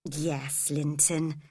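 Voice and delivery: soft and low, tender reply; gentle murmur to a sick cousin; gently. Acted. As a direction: soothe him softly. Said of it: "Cathy said gently".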